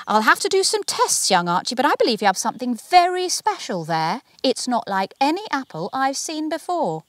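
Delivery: a delighted expert praising the find; excited scientist. A narrator's reading. Bernadette telling young Archie, high and eager.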